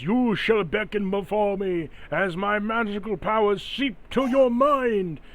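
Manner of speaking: high, slow grandiose villain; dark lord. Acted. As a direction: intone it like a pompous dark lord. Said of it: "it opens its mouth and says".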